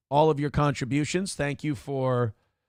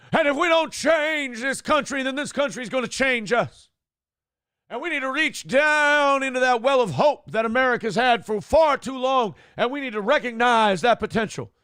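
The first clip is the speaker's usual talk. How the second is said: Barack Obama preaching; 7 semitones higher than usual. Retold or imitated. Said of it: "Baptist Church and he goes".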